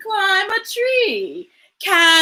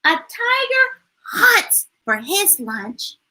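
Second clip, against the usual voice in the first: usual speaking voice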